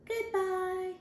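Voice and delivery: high, light little-dog voice; a squeaky cartoon dog saying goodbye. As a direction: pipe it up high like a small dog.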